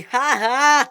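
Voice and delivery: high mocking "ha ha"; high, gloating laugh; screamed laugh. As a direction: fake laugh thrown out as a jeer. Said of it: "screamed Billy".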